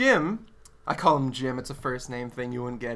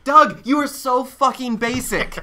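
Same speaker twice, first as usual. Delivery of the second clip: mock scorn; 6 semitones higher, 6 dB louder than usual. Teasing Doug, loud and high.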